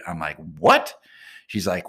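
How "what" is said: higher, louder shocked "what"; stunned disbelief. Retold or imitated. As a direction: blurt it out like you can't believe your ears. Acted quarrel, accused by thief.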